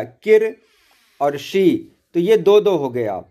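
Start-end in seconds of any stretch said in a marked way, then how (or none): 0.0-0.6 किर punched higher and louder as one joined syllable; stressed syllable. Shown as a demonstration. Teacher stressing the joined syllable किर. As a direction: hit किर as a single strong beat. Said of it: "क और र एक साथ मिल गया".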